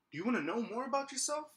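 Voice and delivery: imitating a customer's high, lilting question; prying customer. Lady at the counter quizzing the manager, high-voiced and prying. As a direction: put the question in a mock high voice. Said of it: "she was like".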